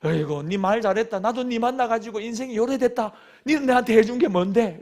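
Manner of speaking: mock retort in Gyeongsang dialect, rising at the end; sarcastic husband in dialect. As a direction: throw the complaint back at her, Gyeongsang style. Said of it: "맞장구를 칠 수 있습니다". (경상도 사투리로 비꼬며 받아치는 남편)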